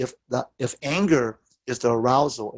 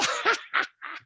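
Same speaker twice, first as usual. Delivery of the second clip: soft laugh; 5 dB quieter than usual. Retold or imitated. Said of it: "So I laughed at him".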